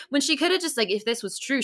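normal talking voice

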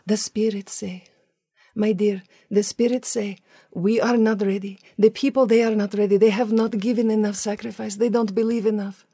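mock pronouncement in a Russian-like accent; spirit-school leader's accent. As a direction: put on the group's Russian-like accent. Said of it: "start using that voice".

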